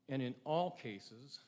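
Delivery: "all" slowed and weighted, the rest even; stress on all. Shown as a demonstration. Speaker to graduates, stressing "all" slowly. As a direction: lean hard on the word "all". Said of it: "and I stress the word all".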